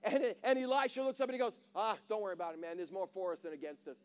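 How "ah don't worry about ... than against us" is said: lower, softer, laid-back calm; calm reassurance. Retold or imitated. Prophet calming his panicking servant, softly reassuring. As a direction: shrug off the danger gently.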